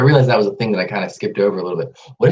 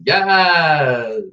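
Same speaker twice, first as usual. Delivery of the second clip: high, loud joyful yes; excited cheer; 8 semitones higher, 4 dB louder than usual. Real, not acted. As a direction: shout it out with joy. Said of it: "laughter yoga cheer".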